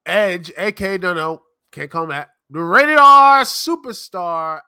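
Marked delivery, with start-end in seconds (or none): 2.5-4.6 wrestling announcer hype. Acted.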